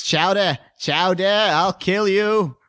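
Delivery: slow, put-on Boston politician; cartoon Boston mayor voice. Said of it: "trying to sound like Mayor Quimby".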